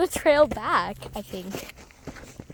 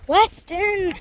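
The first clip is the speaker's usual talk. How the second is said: calling out; 6 semitones higher than usual. Real, not acted. Calling the dog louder.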